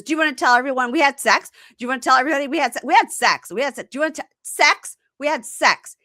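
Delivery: high, loud, fast hammed-up shouting; mock frantic yelling. Imitating the girlfriend yelling at him as he talks, high fast. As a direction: fire it off over him, tripping on words.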